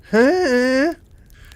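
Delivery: sudden high burst of laughter. Real, not acted. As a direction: laugh out loud at it. Speaker laughs at the scene.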